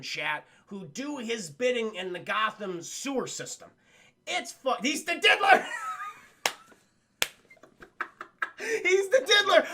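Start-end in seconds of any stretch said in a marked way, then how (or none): 4.3-9.7 loud, high, gleeful exclamation; delighted outburst. Real, not acted. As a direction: shout it out, cracking up. Delighted reaction to a nickname.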